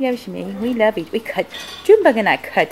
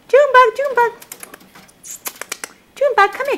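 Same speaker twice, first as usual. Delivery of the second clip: high, loud sing-song call; calling the pet; 7 dB louder, faster than usual. Real, not acted. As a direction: call the pet over from across the room. Calling the guinea pig.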